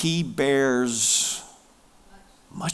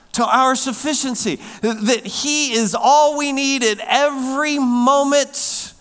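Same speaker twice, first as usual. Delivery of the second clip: higher and louder, rising exhortation; full-force preaching; 8 semitones higher, 5 dB louder than usual. Real, not acted. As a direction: build to a ringing climax.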